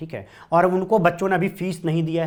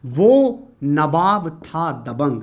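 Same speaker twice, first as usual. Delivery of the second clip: slow mnemonic line; much slower than usual. Shown as a demonstration. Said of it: "क्या लिखा जाएगा?".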